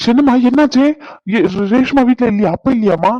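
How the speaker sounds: high, loud, worried questioning; alarmed. Acted. Son on the phone asking crying mother what happened, alarmed. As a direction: fire anxious questions in sudden panic. Son fears grandmother is ill.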